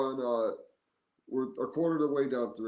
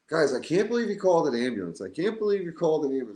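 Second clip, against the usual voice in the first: higher voice, mock exasperated Hank; retold protest; 7 semitones higher, 4 dB louder than usual. Friend retold complaining about the ambulance, mock exasperated.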